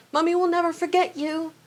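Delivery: lightly voiced Hagrid sobbing farewell; tearful Hagrid. A narrator's reading. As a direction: coo it like a heartbroken mother.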